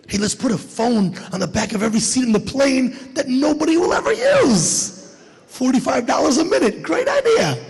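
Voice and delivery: high, over-excited pitch for a dumb idea; sarcastically gleeful brainwave voice; mock genius inventor. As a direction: pitch it proudly, climbing to a squeal. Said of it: "I don't know what genius thought".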